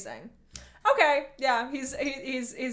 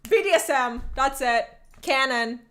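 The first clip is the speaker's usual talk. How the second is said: normal talking voice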